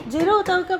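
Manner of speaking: loud call across the house. Real, not acted. Mother calls Jiro to come to Papa, loud call. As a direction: call him from another room.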